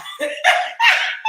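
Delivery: high, loud burst of laughter; cracking up at the clip. Real, not acted. Host bursts out laughing at the video.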